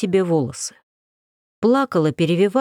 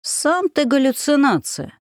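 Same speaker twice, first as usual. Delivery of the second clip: высокий медленный старушечий ворчливый голос; ворчать как сварливая бабка; ворчание; 8 semitones higher than usual. Acted. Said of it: "проворчала Баба Яга". (ворчливая Баба Яга)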